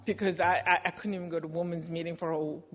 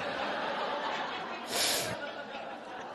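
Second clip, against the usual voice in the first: 소리 없이 숨으로 웃는 웃음; a soft airy laugh under her breath; breathless laugh; 5 dB quieter than usual. Real, not acted. Laughs at own retort.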